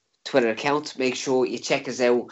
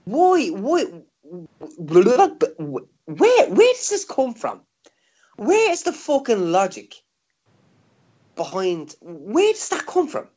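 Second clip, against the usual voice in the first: high, exasperated questioning; 7 semitones higher, slower than usual. Real, not acted. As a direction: rant in disbelief, voice pitched up. Exasperated rant about logic.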